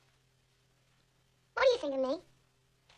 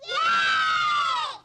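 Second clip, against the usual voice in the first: put-on kids' cheer; 10 semitones higher, 6 dB louder than usual. Kids cheer on cue.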